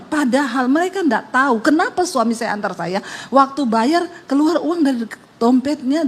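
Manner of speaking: ordinary delivery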